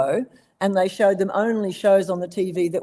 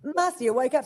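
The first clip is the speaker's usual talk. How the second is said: much higher cartoon koala voice, nudging a sleeper; a cheeky young koala trying to wake his friend; Blinky Bill voice; 6 semitones higher than usual. Voice actor reprising Blinky waking Wombo, high cartoon koala voice. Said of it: "then he goes to little, you know, to, um, Marcia".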